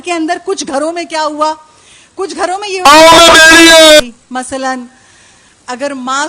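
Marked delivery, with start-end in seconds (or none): 2.2-4.0 loud and drawn-out, x0.5 slow; emphatic shout. Real, not acted. Preacher booming slowly at the mourners. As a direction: boom it out slowly to the gathering.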